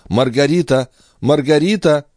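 high-pitched call for help. A narrator's reading. Mistress summoning her sleeping maid, calling out high. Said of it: "призвала на помощь все силы и позвала".

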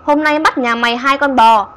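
giọng ma éo éo chế nhạo; shrill ghost voice. Acted. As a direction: sneer at the old woman like a spirit. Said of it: "Cái bóng trắng lại éo éo".

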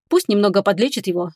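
ordinary delivery, nothing marked

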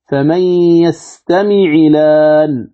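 slow chant of "يستمع لان" as yastami'i-laan, no hamza; level tajweed chant, gliding "يستمع" into a long "لان"; hamza vowel transferred. Shown as a demonstration. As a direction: recite "فمن يستمع لان" slowly, 'ayn with an i, lam taking the vowel. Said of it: "بالنقل حركة الهمز إلى اللام".

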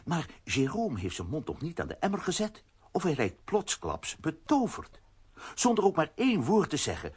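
ordinary delivery

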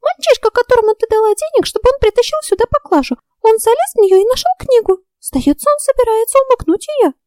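high, squeaky alarmed fairy. A narrator's reading. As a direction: blurt it out as a panicked fairy.

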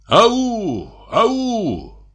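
high, long halloo; calling out. A narrator's reading. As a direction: hail someone across the fields. Hunters hail the other group. (высокое протяжное «ау»)

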